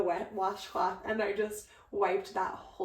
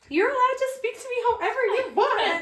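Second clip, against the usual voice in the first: high, louder, laughing forgiveness; high laughing reassurance; 14 semitones higher, 6 dB louder than usual. Retold or imitated. Bride quoting her sister forgiving her, high and laughing. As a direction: gush it through a laugh.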